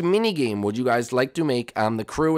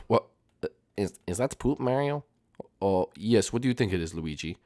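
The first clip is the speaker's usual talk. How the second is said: ordinary talk, nothing marked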